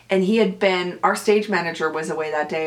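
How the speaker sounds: ordinary talk, nothing marked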